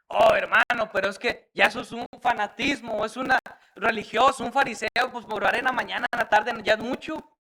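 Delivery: hammed-up protest, high and loud; mock objection. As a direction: act out a brother complaining it's too much. Acts out an objecting church member.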